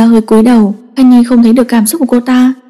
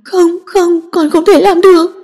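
higher, choking tearful no; choked refusal; 7 semitones higher than usual. A narrator's reading. Daughter refusing her father, choked with tears. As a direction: refuse through a choked throat. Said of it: "lắc đầu nghẹn ngào".

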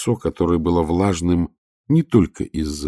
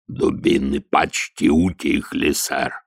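troll sergeant voice; 2 semitones lower, slower than usual. Acted. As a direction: speak like a slow, steady troll. Detritus the troll speaks.

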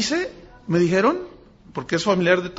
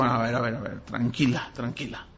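low, soft sleepy calming voice, trailing off quieter; half asleep, calming; 7 semitones lower, 8 dB quieter than usual. Retold or imitated. Pastor woken by a dawn call, calming the frightened woman, low and drowsy. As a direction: murmur it like someone just woken, soothing. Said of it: "Y yo medio dormido".

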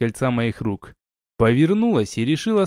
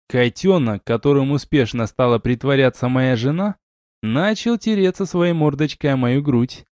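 ordinary delivery, nothing marked

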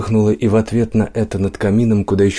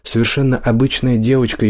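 plain reading voice

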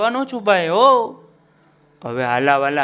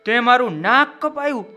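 rising, higher angry outburst at the dog; angry cry; 7 semitones higher than usual. A narrator's reading. Trader screaming at his returning dog, angry. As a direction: cry out in betrayed fury. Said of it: "વણઝારાએ ચીસ પાડી".

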